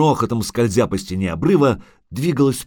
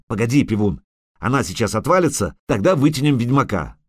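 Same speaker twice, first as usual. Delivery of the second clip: usual speaking voice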